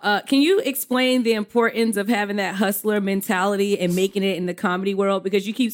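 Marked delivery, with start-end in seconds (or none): none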